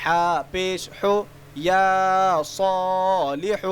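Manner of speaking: slow, drawn-out chanted letter recitation; sing-song spelling drill. Shown as a demonstration. Teacher spelling letters aloud for children, slow sing-song chant. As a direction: chant each letter and its vowel for young learners.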